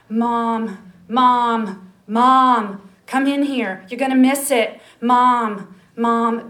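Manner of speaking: put-on little boy hollering Mom, come see; child calling loudly. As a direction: shout for mother from the next room.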